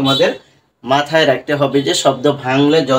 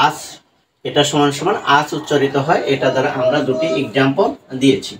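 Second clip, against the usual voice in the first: ordinary talk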